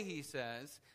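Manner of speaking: ordinary talk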